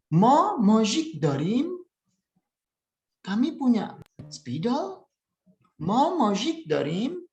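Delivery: high, slow, rising at the end of dorim and spidol; question intonation. Shown as a demonstration. Teacher models question for learners, high rising intonation. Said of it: "itu adalah intonasi bertanyanya".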